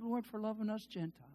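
ordinary talk, nothing marked

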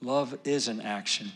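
normal talking voice

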